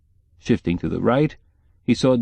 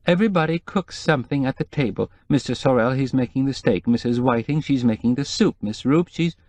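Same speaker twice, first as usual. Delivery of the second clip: higher, anxious housekeeper voice; lightly voiced worried housekeeper; nervous housekeeper; 4 semitones higher than usual. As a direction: play the fretful housekeeper, pitched up.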